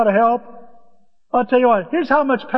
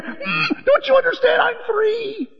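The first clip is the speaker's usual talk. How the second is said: put-on toddler voice; 14 semitones higher, slower than usual. Voicing three-year-old.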